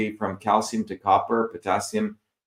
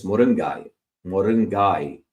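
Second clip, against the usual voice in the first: moringai in a Tamil accent, tapped r and "guy" ending; Tamil-accented moringai, quick tongue-tap on the r; 2 semitones lower than usual. Shown as a demonstration. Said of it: "if you put a Tamil accent".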